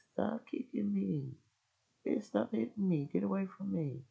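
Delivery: high mock whine of the husband; husband's whine. Husband complaining while wife kicks, high whiny mock voice. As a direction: play him whining high.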